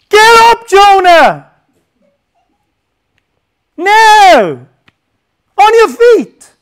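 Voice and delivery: shouted commands, high and loud; urgent shout. Retold or imitated. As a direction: bark it like an urgent order to run. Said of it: "That's the emphasis".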